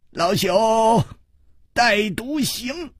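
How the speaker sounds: high-pitched, chuckling elder; smiling old man. A narrator's reading. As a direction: greet him as an amused old-timer, voice up high. Old man introduces himself.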